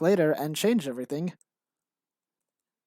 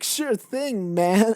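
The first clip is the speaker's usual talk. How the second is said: bro reply; 7 semitones higher, 6 dB louder, much slower than usual. Retold or imitated. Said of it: "everyone was like".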